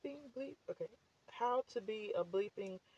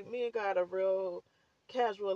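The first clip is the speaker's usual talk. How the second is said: ordinary talk, nothing marked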